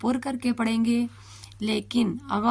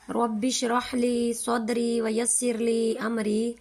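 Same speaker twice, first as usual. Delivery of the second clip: recited prayer; slower than usual. Acted. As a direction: recite the dua devoutly. Closing Quranic prayer. (عربی دعا کی تلاوت)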